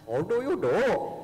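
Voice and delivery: lilting Sri Lankan English; Sri Lankan accent. Retold or imitated. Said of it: "with my Sri Lankan accent".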